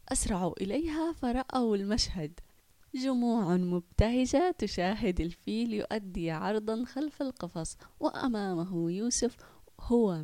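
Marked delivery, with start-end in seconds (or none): none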